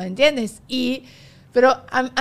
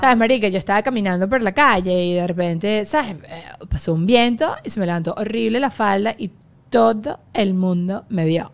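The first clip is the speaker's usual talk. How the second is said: Venezuelan fresa accent. Shown as a demonstration. Showing her friend how Venezuelan fresa girls talk, Venezuelan fresa accent. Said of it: "dime cómo hablan las fresas venezolanas".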